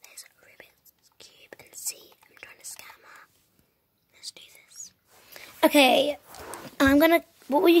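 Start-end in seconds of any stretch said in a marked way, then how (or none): 0.0-4.9 hushed whisper so nobody overhears; secretive whisper to the camera. Real, not acted. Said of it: "I have to whisper so she doesn't hear me".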